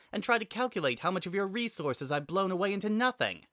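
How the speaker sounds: put-on needling her old enemy with her victories; sarcastic Samus. A narrator's reading. Narrator voices Samus taunting.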